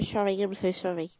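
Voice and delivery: S's slurred to SH in "sorry"; mock Doctor impression. Co-host mimics the host's Doctor impression, slushy "sorry". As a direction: teasingly copy the impression, "sorry" as "shorry". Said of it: "he was like".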